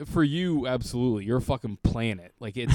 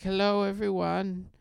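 lightly voiced singer greeting the crowd; performer greeting; 9 semitones higher than usual. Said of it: "she's like".

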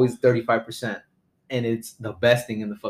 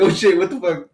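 a sudden loud startled curse; 6 semitones higher, 6 dB louder than usual. Retold or imitated. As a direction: jolt awake in shock. Waking up suddenly in car.

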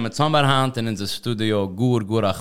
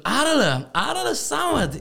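retold greeting, much higher and slow on Samet; excited greeting; slower than usual. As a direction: call Arle, Arle Samet up high, drawn out. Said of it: "ich habe dich gesagt".

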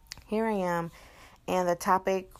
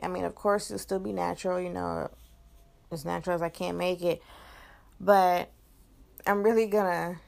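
normal talking voice

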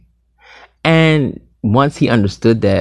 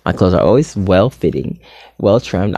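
normal talking voice

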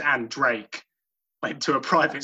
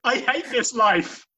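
melodramatic despair; 10 semitones higher than usual. Acted. Jeff Ross voiced.

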